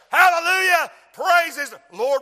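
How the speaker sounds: loud high hallelujah; shouted praise. Real, not acted. Preacher shouting praise to the congregation. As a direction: shout it out to heaven.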